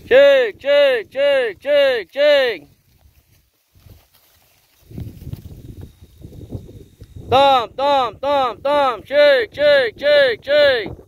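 high, loud call to the herd; calling horses. Real, not acted. Farmer calling his mares in from the pasture, loud high call. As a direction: sing out to bring the mares in.

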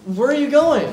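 high loud panicked question; alarmed shout. Acted. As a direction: call after him in alarm. Student panics as teacher leaves.

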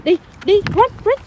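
high, loud call to run. Real, not acted. Calling child to run.

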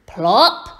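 loud, stern call of the name; scolding call. A narrator's reading. Woken mother owl calls her chick, loud and scolding. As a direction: call out sharply to a naughty child.

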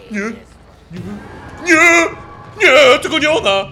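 high, loud terrified yell; panicked shout. Real, not acted. Streamer sees the grandma return, panicked yell. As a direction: scream it out in panic. Horror game monster appears.

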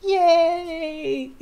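high drawn-out happy squeal; cheer, about to giggle; delighted cheer. Real, not acted. Reader thrilled at the elf's arrival, delighted cheer.

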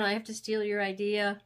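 ordinary talk, nothing marked

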